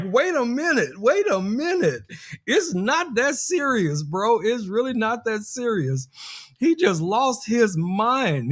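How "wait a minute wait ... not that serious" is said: pleading to calm him down. Retold or imitated. To Louis, who is shooting at him: imitating a raised, pleading voice. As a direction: talk a hothead down, pitched up. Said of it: "I was like".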